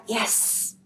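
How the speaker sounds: slow, lightly voiced confession of hate; voicing a hurt listener. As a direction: let the wounded listener admit it slowly. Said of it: "yang kau hari ini berkata".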